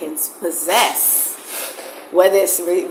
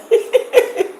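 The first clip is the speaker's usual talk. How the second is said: breathless laugh. Real, not acted. Breathless laugh after joke.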